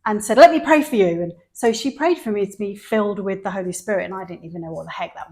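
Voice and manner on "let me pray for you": loud, high and insistent; eager. Retold or imitated. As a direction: offer it with urgent enthusiasm. Eager woman insisting on praying.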